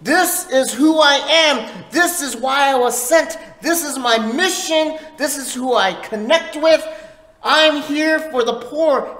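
full-force preaching. Acted. Preacher proclaiming to the church, loud and high. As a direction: proclaim it to the whole congregation.

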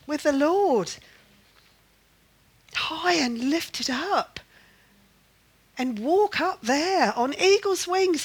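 high, emphatic preaching with long pauses; exhorting preacher. Real, not acted. Preacher urging believers above circumstance, exhorting. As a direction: lift each phrase up, urging.

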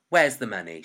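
loud, firm demand. A narrator's reading. Shopkeeper wanting payment from a boy, loudly demanding. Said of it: "she demanded".

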